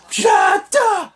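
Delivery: high, loud hype yell; excited shout. Retold or imitated. Fan hyping up the coup de grace, excited yell. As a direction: belt it out like a fan at ringside.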